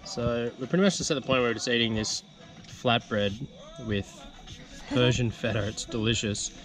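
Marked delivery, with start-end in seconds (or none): none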